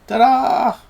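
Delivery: high, loud fanfare reveal; showy ta-da. Real, not acted. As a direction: announce it with a big flourish. Showy reveal of party member.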